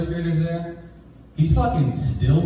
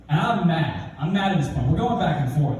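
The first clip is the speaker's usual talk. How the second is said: normal talking voice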